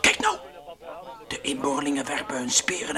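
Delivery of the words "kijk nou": much higher startled exclaim; surprised. Acted. Frans amazed as the natives lay down weapons. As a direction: blurt it out in amazement.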